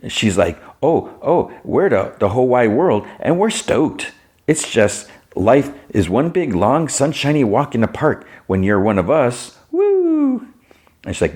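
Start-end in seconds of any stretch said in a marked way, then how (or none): 0.8-10.5 sugary sing-song, pitched much higher; mock her fake perkiness; mock smiley chirp. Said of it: "All smiley".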